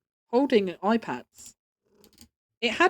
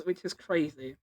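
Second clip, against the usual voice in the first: usual speaking voice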